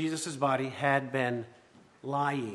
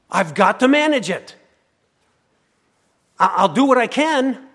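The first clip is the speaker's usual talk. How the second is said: higher, louder, frantic Mary; Mary insisting she must manage the chaos, anxious; 6 semitones higher, 9 dB louder than usual. Acted. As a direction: voice Mary's desperate need to fix it. Voicing Mary's anxious resolve.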